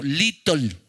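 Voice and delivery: "little" said the Honduran-accent way, like litol; a quick, playful Honduran-accent "little". Shown as a demonstration. Pastor jokes that the church says "little" with a Honduran accent. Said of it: "Dígalo con acento hondureño".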